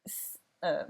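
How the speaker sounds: normal talking voice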